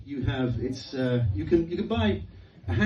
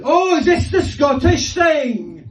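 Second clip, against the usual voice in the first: put-on high, slow, loud Scottish accent; mock Glaswegian Billy Connolly; 13 semitones higher, 8 dB louder, much slower than usual. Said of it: "Billy Connolly ... said".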